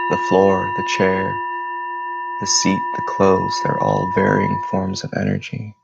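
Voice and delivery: measured meditation voice with long pauses between phrases; guided meditation. Real, not acted. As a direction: guide listeners through their breathing, phrase by phrase. Leads a breathing meditation.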